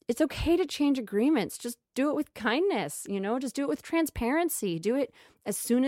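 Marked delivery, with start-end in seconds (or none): none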